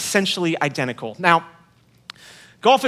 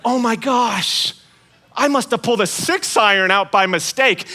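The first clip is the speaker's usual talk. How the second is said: fake surprise; 4 semitones higher than usual. Pretending to his friend he grabbed the wrong club, hammed-up surprise. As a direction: overplay the discovery. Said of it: "I acted surprised".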